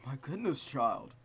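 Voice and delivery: a hint of a kindly priest; lightly voiced gentle priest; priest's voice. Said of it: "get a priest's voice going".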